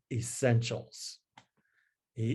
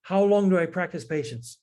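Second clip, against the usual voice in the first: much higher, flustered priest's voice; worked up; 6 semitones higher, faster than usual. Retold or imitated. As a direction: play the priest getting all worked up. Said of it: "the virtue of patience".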